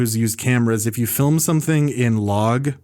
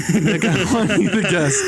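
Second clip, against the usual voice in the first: higher, slower, through laughter; laughing retake; 6 semitones higher than usual. Shown as a demonstration. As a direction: redo the question while cracking up. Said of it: "Nick, let's just not edit this".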